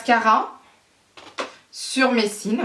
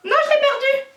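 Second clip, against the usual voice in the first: very high, dismayed squeal; panicked cry; 3 dB louder than usual. Real, not acted. Vlogger who just lost her lash, high panicked cry.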